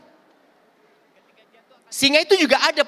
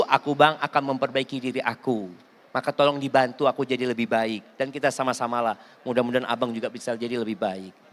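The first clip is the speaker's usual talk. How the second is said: low, soft and sincere; humble apology; 7 semitones lower, 5 dB quieter than usual. Acted. Wife apologising to her husband, soft and sincere. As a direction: gently offer to change first. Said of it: "Istri ngomong dulu".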